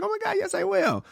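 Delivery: high falsetto mock of an eager lady; excited lady. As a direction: squeal it like she just won the lottery. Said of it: "She was like".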